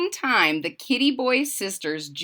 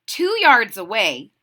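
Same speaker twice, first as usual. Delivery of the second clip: ordinary delivery, nothing marked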